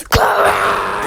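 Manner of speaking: loud, breathy call of the name; shouted name. Acted. Teacher shouting at Chloe, loud. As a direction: yell her name across the room. Calling out the accused student.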